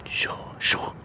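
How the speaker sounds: hushed agreeing whisper. Real, not acted.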